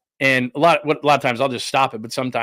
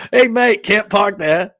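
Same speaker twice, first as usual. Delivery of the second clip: loud, high drive-by call-out; mock heckler shout; 7 semitones higher, 6 dB louder, slower than usual. Someone driving by a crash, mock shouting. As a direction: holler it like a teasing passer-by.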